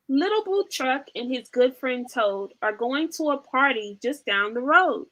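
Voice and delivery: lively, sing-song storybook reading; animated picture-book read-aloud; reading to children. Acted. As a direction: read it like bedtime for toddlers.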